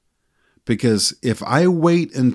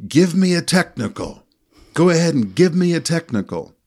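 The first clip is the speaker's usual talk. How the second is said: higher-pitched mock yell at the referee; imitating an angry coach, pitched up high; taunting the referee with a pitched-up mock yell; retold coach yell; 7 semitones higher than usual. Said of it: "He then yelled and said".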